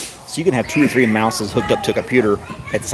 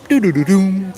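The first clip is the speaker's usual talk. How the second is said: imitated startup chime; 10 semitones higher, 5 dB louder than usual. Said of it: "You'd go".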